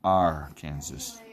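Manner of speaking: low, slow "Arkansas" said ARRR, KAN-zus; Ar-Kansas mispronunciation. Shown as a demonstration. Bracket picker spelling out the joke, "Arkansas" slowly said Ar-Kansas. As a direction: split "Arkansas" into a drawn-out "Ar" and "Kansas". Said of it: "Not yours".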